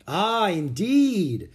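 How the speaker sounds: slow, high, swooping exclamation; impressed realisation. A narrator's reading. Governor Bradford accepts the boy's story, impressed. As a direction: let it dawn on you grandly.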